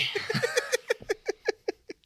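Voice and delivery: breathy villain laugh, high; evil cackle. Pretend. Villain persona mock laugh.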